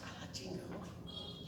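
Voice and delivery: hushed, voiceless aside; whispered aside. Real, not acted. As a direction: drop to a whisper for the slang. (cuchicheo sin voz)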